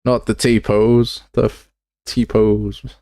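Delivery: loud, drawn-out dismay; anguished groan. Real, not acted. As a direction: drag it out in real despair. Character snaps into T-pose.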